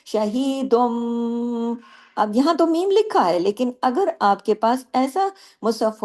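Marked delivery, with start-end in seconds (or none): 0.0-1.7 very slow شہیدم with a long humming meem; slow Quranic recitation; iqlab to meem. Shown as a demonstration. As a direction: draw out the meem sound in شہیدم. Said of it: "میم کی آواز آتی ہے".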